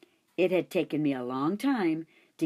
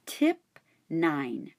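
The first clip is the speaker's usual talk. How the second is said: plain reading voice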